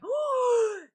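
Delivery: high, loud gasped "oh"; mock delight. Brain spotting McDonald's on the drive home, mock excited gasp. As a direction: gasp with sudden put-on craving.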